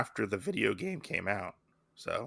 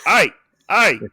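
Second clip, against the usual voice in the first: loud Australian call, pitched up; Australian accent; 7 semitones higher, 10 dB louder than usual. Acted. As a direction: try out a punchy Australian shout. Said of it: "go with your Australian accent".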